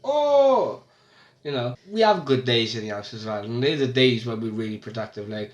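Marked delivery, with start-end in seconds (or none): 0.0-0.8 delighted gasp. Retold or imitated. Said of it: "and you're like".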